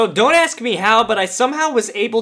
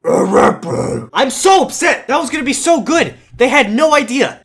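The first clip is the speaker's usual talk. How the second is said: upset ranting; 5 semitones higher, 4 dB louder than usual. Real, not acted. Ranting after ruined disguise.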